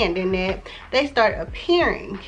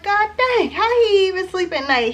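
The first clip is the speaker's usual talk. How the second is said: high, louder appalled exclamation; incredulous outburst; 11 semitones higher, 4 dB louder than usual. Retold or imitated. Reacting to all the bodies Tariq is linked to, incredulous. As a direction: marvel in disbelief, pitch way up.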